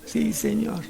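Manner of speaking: very soft, yielding assent; hushed humble answer. Acted. Believer answering God's voice, hushed and yielding. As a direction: answer God almost under your breath.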